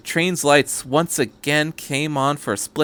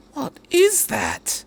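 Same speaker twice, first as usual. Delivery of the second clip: much higher, slow, fascinated question; 13 semitones higher, much slower than usual. A narrator's reading. Doctor stares at the angel, fascinated and slow. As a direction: marvel at the creature. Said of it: "He sounded almost fascinated".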